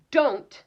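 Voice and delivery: "Don't" shot up high and loud; teacher showing the bold word "Don't"; stress on DON'T. Shown as a demonstration. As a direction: hit "Don't" hard. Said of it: "again, is bold".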